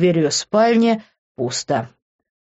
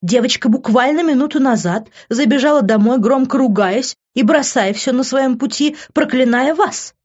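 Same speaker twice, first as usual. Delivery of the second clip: indignant scolding; 7 semitones higher than usual. A narrator's reading. Housekeeper scolds Mr Keane over the phone, indignant. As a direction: scold the boss for what he did. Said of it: "возмутилась женщина".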